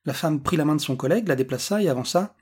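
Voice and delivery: ordinary delivery, nothing marked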